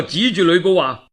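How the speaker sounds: plain reading voice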